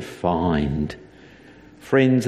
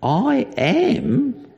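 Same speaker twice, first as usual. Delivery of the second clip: high, shocked "I am?"; a mock gasp of disbelief; incredulous crowd. People hearing Jesus' claim, a high shocked question. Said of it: "people started saying".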